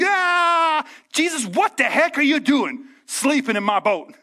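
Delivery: high, exasperated plea to a sleeping Jesus; mock frantic disciple; frantic complaint. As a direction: demand why he is sleeping.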